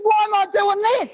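very high, bewildered mock protest; exasperated questioning. Comedian posing for a stranger's photo, mock exasperation.

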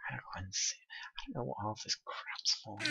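hushed whisper to himself; whispered aside. Real, not acted. Puzzling over his pile of gear, a soft whisper. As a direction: whisper it under your breath.

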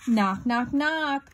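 knocking sound. A narrator's reading. Reader acts out the noise at Gregory's burrow, knocking sound.

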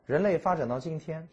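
ordinary delivery

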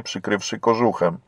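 ordinary delivery, nothing marked

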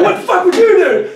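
very high, loud laughing retort; very high incredulous shout with a laugh; laughing mock outrage. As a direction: yell it back through laughter.

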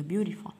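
beautiful with a soft flapped T, like "byoo-rih-ful"; American pronunciation. Shown as a demonstration. Teacher demonstrating the American dialect, "beautiful" with a tapped T. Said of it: "باللهجة الأمريكية".

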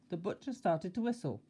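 usual speaking voice

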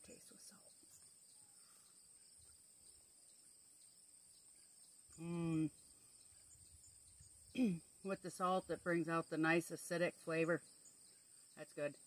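chewing between words; talking while eating. Real, not acted. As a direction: food at lips. Seen 0: Holds tomato slice at mouth, talks between bites, bites again near end.

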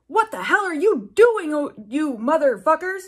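loud, high put-on furious old wizard; outraged yell. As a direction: bellow at them as a scandalized headmaster. Dumbledore bursting in, outraged.

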